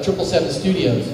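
ordinary talk, nothing marked

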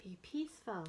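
bright, expressive picture-book reading; reading to children. Acted. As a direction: read it out to a circle of little kids. Librarian reads picture book aloud.

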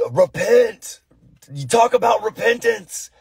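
high, loud strained accusation; hammed-up righteous outrage; mock angry zealot. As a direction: parody an indignant comment-section scold. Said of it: "this is the voice in the mind".

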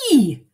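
sudden loud yelp of pain; howl. Acted. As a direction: yelp as if something bit you. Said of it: "howled Mister McGee".

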